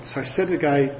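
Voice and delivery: ordinary delivery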